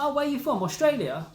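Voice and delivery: high mock surprise "Oh, where are you from? Australia?"; imitating surprised local. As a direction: squeak it out like a startled stranger. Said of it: "He was like".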